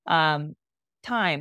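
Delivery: usual speaking voice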